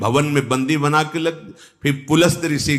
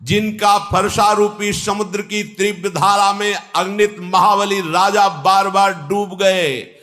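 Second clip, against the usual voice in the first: mildly angry rebuke climbing into raised proclamation; Angad's indignant anger; 6 semitones higher than usual. Acted. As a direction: declaim in righteous indignation, settle on the close. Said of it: "Angad जी को आप क्रोध आया". (अंगद का हल्का क्रोध भरा, जोशीला उद्घोष)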